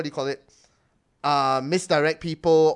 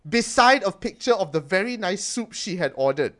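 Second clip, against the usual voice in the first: ordinary talk, nothing marked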